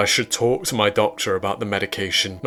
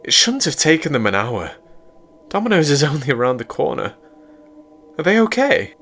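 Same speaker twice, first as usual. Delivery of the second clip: put-on higher, anxious girlfriend voice; higher worried girlfriend, rising at the end; 7 semitones higher, faster than usual. A narrator's reading. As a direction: voice her growing worry. Narrator voices April, worried.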